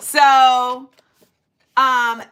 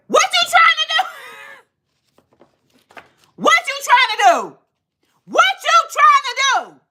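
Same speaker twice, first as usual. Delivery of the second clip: angry yell; 13 semitones higher than usual. Retold or imitated. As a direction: confront her, yelling the question. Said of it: "And I said".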